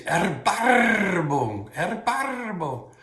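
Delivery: high, loud Baltic German with long rolled R's; Baltic German accent. Shown as a demonstration. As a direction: draw it out in Baltic German, trilling the R hard. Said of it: "really roll those R's ... Baltic German would say".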